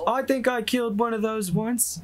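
high-pitched character voice; Tharis's voice. Acted. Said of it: "Tharis just goes".

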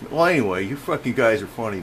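ordinary talk, nothing marked

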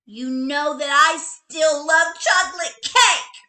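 high, loud sulky retort; little bunny answering her mother loudly; loud whiny child. A narrator's reading. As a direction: throw it back at mom, pouting.

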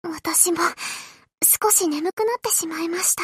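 soft drowsy whispered sigh; sleepy whisper. Acted. Carrier dozing off at night, whispering to the admiral. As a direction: yawn the words out, half asleep.

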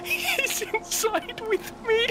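high scared laugh; panicked laughing. Real, not acted. Mob inside, panicked laughing.

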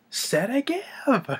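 very high, slow, wheezing word; strangled question. Retold or imitated. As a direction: squeeze it out, choking. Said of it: "Gene Wilder's like".

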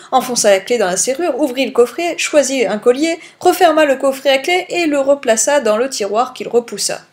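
usual speaking voice

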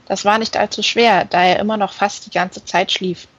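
ordinary delivery

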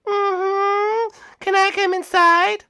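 very high, slow squeaky Kirby. Acted. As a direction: say it like a tiny pink puffball. Kirby asks to come in.

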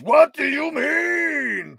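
slow, loud, high shouted question; outburst. A narrator's reading. King interrupting the hero, shouting loud and slow. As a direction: cry out in disbelief. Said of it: "cried Hrothgar".

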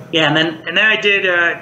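usual speaking voice

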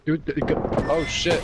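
frustrated yell at teammate; raging gamer. Real, not acted. Teammate dies, player rages.

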